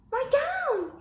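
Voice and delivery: high, very loud happy shout; joyful yell. A narrator's reading. George finding his gown, joyful loud yell. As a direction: yell with delight. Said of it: "he yelled".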